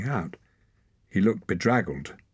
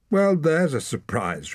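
higher, slow mocking remark; sarcastic girl; 10 semitones higher, 4 dB louder than usual. A narrator's reading. Ren teasing Torak before the clan, higher and mocking. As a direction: drip a little sarcasm. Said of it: "remarked Ren".

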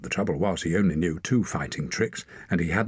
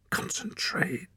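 whispered, tense order to self; grim self-whisper; 13 semitones higher, 6 dB quieter, slower than usual. A narrator's reading. Torak whispers to himself mid-fight, grim. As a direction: hiss it to yourself. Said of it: "he told himself grimly".

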